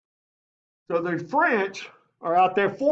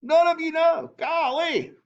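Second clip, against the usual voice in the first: mock dismay; 12 semitones higher than usual. Teacher mocks the silent class.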